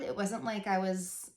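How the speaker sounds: normal talking voice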